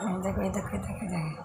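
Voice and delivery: soft, hushed "look, look"; quiet, fading murmur of "এই দেখো". Real, not acted. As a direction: drop to a near hush so you don't startle the bird. Hushed, watching the scared bird.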